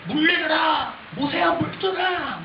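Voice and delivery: high, drawn-out crowd yell; clamoring shout. Acted. Thirsty people clamor at Moses for water, shouting high. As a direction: shout the demand like an angry mob. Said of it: "아우성을 치고 있습니다".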